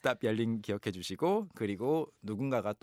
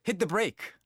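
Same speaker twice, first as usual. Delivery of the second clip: high, urgent mock shout; imitated yell; 9 semitones higher, 4 dB louder, much slower than usual. Husband helping wife practice driving, mock yelling. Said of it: "he yelled at me".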